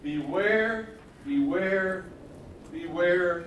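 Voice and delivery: BEWARE said three times, each firm and loud; emphatic threefold warning. Retold or imitated. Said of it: "this threefold warning".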